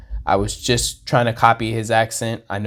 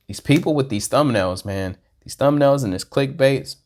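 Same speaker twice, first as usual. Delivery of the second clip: ordinary delivery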